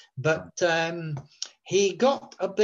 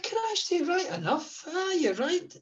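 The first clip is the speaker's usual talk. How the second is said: high, softer astonished voice; imitated amazement; 9 semitones higher, 3 dB quieter than usual. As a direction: marvel at it, pitched way up. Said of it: "He said".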